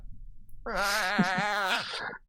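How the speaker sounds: high, drawn-out creature squeal; Gretchen squeal. Acted. Game master answers the player as the Gretchen, high squeal. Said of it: "That is what the Gretchen says to that".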